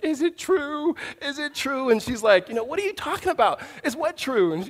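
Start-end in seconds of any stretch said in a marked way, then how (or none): 0.0-1.9 put-on high, whiny little-boy voice; high upset kid asking if it's true; crying little brother. 2.5-4.5 lightly voiced mom, higher and quick; a hint of a puzzled mother.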